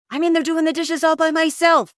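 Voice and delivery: high, loud exasperated outburst; angry girlfriend, imitated. Girlfriend storms out at her boyfriend over the dishes, angry. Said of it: "she comes out and she's so angry".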